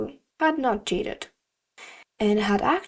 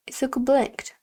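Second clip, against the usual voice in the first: plain reading voice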